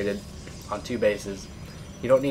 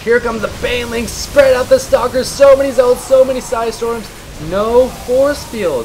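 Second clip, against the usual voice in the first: much higher and louder, shouting play-by-play as the fight hits; excited; 12 semitones higher, 11 dB louder than usual. Real, not acted. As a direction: yell it out as the banelings crash in. Caster hypes big fight.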